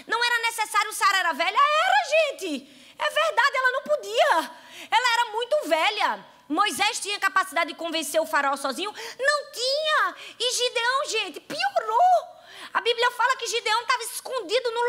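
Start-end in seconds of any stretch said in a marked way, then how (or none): 1.6-4.5 excited exclamation. Real, not acted. Preacher exclaims over Sara's age. 9.2-12.4 excited exclamation. Real, not acted. Preacher building over Gideon's fear.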